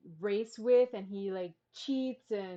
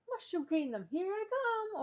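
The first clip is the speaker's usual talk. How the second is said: put-on light Italian accent; 11 semitones higher than usual. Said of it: "a little Italian accent coming out".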